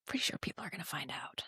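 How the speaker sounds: quick, hushed whisper aside. Real, not acted. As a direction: whisper it like a secret joke. Sly aside about husband.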